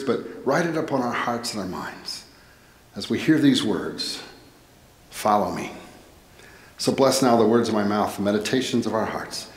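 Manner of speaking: low, measured pastoral prayer voice. Acted. Pastor opening the sermon, praying over the church, reverent prayer.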